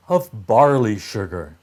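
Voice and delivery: ordinary delivery, nothing marked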